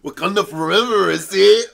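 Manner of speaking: laughing, badly faked Wakandan accent; mock Wakandan accent. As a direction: mimic a botched Wakandan accent through laughter. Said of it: "That was the wrong accent".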